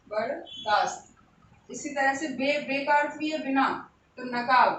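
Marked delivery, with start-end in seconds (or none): 0.1-1.1 वरदास्त stretched out slowly, syllable by syllable, ending low; very slow teacher's dictation of वरदास्त. Shown as a demonstration. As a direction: spell वरदास्त out slowly for the class to write down. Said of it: "तो वरदास्त".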